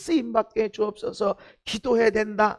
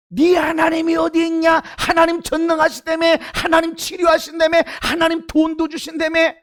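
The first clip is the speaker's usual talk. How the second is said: mocking taunt; 5 semitones higher, 6 dB louder than usual. Preacher voicing a mocking challenger.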